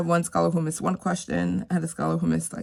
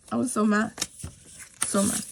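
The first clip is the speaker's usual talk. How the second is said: ordinary delivery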